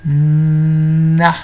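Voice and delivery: "Na" with a long loud hummed n before the a; "Na" stretched so the n stands out; drawn-out first sound. Shown as a demonstration. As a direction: hold the n of "Na": nnnnn-a. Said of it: "Qu'est-ce qu'on entend?".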